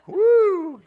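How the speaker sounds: high, loud "woo"; loud celebratory holler; excited whoop. Real, not acted. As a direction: let out a delighted high whoop. Whooping after reading just right.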